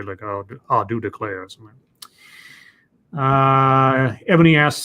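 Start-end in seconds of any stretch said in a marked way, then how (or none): 0.2-1.4 lightly voiced Southern cowboy, lower; a hint of low, manly Western twang. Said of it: "John Wayne or Cowboys ... manly".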